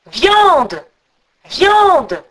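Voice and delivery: loud, high, falling calls; eagle's cry. A narrator's reading. Eagle calls to its rider for food, loud swooping cry. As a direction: cry it out twice, long and swooping. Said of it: "l'aigle cria de nouveau".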